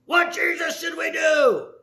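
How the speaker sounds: high, loud angry crowd yelling; ramped-up shouting accusers; angry demanding shout. Acted. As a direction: push him for an answer, furious. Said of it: "wanting them to ramp it up".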